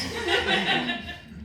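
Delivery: helpless giggle fit; cracking up mid-read; burst of laughter. Real, not acted. Reader cracks up.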